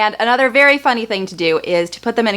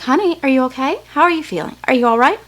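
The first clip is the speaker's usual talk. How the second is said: higher, sweet nurse voice asking gently; the nurse checking on little Matt, higher and gentle; worried nurse; 6 semitones higher, faster than usual. Retold or imitated. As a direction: coax a stunned toddler to answer. Nurse voiced in brother story.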